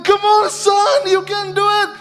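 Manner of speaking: high, tense urging; straining to hold back. Retold or imitated. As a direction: cheer him on through a bitten lip. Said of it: "Sambil saya tutup mulut saya".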